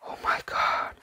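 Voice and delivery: a soft, breathless whisper; awed whisper. Real, not acted. Finding the book's pages, awed whisper. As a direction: gasp it under your breath. Overwhelmed by book contents.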